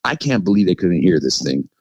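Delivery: usual speaking voice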